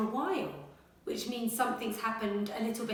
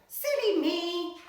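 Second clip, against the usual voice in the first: silly pig voice; 8 semitones higher, 6 dB louder, slower than usual. Acted. Pig scolds himself.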